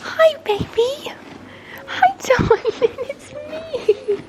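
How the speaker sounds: sing-song pet talk to a horse. Real, not acted. Greeting her pony.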